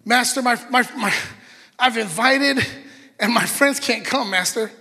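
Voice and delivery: high, loud, flustered whine; a servant fretting and pleading; whiny pleading servant. Acted. Servant pleading with his master, high whine.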